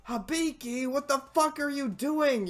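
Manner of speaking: high, loud disbelieving shout; what-are-you-doing shout; exasperated yell. Real, not acted. Yelling at character Hibiki.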